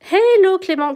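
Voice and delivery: high, loud hello; excited greeting. Real, not acted. Greeting viewer Clément excitedly in the live chat. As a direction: call out happily to a viewer.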